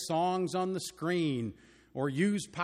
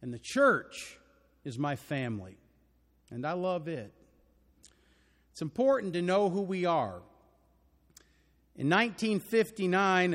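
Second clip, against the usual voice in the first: ordinary talk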